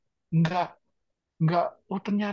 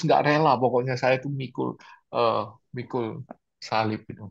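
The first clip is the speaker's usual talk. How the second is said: ordinary talk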